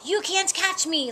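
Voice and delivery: high, loud teasing "ha ha"; laughing taunt. A narrator's reading. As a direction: taunt them with a storybook laugh. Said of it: "laughed the tabby cat".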